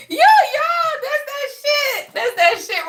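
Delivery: elated call out; excited. Real, not acted. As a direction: hype it up. Excited recognition.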